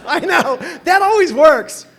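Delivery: loud, high ironic exclamation; mock your own bad idea; loud, high sarcastic aside. Real, not acted. Self-mocking about internet girlfriend.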